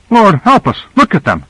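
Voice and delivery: a high, revolted cry at the sight; disgusted exclamation. A narrator's reading. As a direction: recoil in disgust as you say it. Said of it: "With an expression of distaste and nausea".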